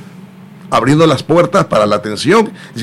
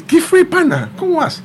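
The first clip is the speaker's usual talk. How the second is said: much higher-pitched buddy hello; mimicked friendly greeting. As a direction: imitate a pal greeting him, pitched way up. Acted greeting from a doctor friend. (saludo de amigo imitado, en tono muy agudo)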